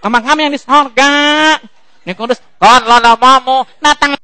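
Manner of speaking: high, loud, gabbled prayer in a put-on voice; mock garbled prayer. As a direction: rattle off the prayer like a half-awake churchgoer. Mumbled prayer shouted high.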